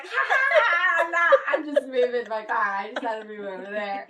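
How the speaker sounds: big fake laugh, then breezy excuse; mock Mom, fake laugh. Mimicking Mom brushing off her kids with a laugh. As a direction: play it off like nothing happened. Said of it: "And she's like".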